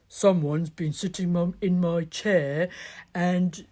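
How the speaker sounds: gruff-ish bear dad noticing his chair, only a hint; Daddy Bear. Acted. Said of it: "Daddy Bear said".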